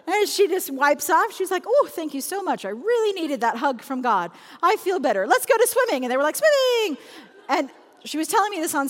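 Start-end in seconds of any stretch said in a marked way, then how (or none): 1.7-6.0 acted relief, building to a high, loud cheer; relieved mom. Mom to kids after her cry, brightly relieved. 6.4-7.0 put-on high, loud sing-out like little kids; cheering kids. Kids replying to mom on the way swimming, cheering.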